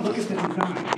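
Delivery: usual speaking voice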